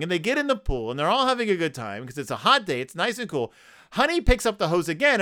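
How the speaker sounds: ordinary delivery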